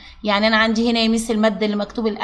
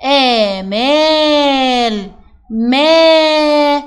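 "آمال" drawn out, then "ما" held long and louder; vowel held long; 3 semitones higher, 5 dB louder, much slower than usual. Shown as a demonstration. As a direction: stretch the "aa" after the meem in "ما". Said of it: "هطول في صوت حرف الميم". (تطويل صوت الميم الممدودة في "آمال")

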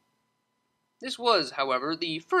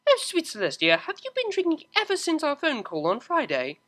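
much higher exasperated mare voice; incredulous scold; Octavia; 12 semitones higher than usual. Acted. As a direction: cellist realizing her friend is drunk, higher.

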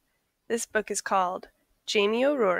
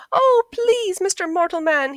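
very high, louder begging little voice; pleading leprechaun; 9 semitones higher, 5 dB louder than usual. A narrator's reading. Leprechaun begging Jamie to release him, high pleading. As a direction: plead like a tiny creature for mercy. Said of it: "he pleaded".

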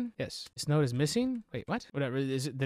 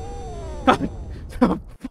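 startled laugh; 11 semitones higher than usual. Real, not acted. Laughing after jump scare.

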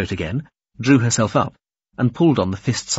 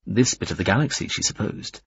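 plain reading voice

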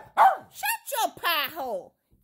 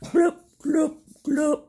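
gulping glubs; 6 dB louder than usual. Pretend. Gulping noise in song.

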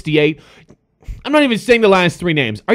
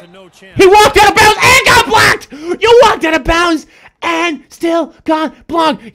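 mocking shout; 8 semitones higher than usual. Real, not acted. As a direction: shout it in the opponent's face.

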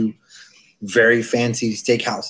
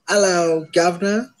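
mock British greeting; 5 semitones higher than usual. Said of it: "or is it hello".

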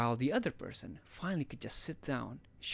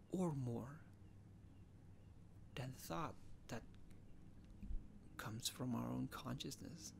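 very soft, halting, near-whispered musing; pondering monologue; 11 dB quieter than usual. Acted. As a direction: break the thought into hushed fragments. Self-aware dramatic monologue.

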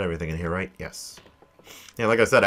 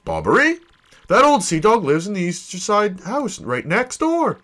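higher-pitched, emphatic explainer voice; professor character; 7 semitones higher than usual. Acted. Professor tells hero where the sailor lives, informative. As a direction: recall the old sailor with lively swoops.

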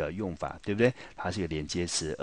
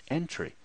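"entry" with the weight on EN and a light try; teacher modeling the stress in "entry"; stress on first syllable; 9 semitones higher than usual. Shown as a demonstration. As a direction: lean on EN in "entry" and let try drop. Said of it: "我们就念成Entry".

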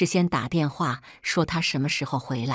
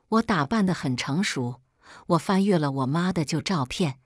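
ordinary delivery, nothing marked

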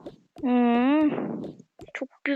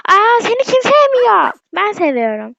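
high, loud and slow, drawn out; mock surprise; 7 semitones higher, 6 dB louder than usual. Real, not acted. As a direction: act shocked that anyone could doubt it. Reassuring the dog dramatically. (köpeğe seslenirken abartılı bir şaşkınlıkla, yüksek ve tiz)

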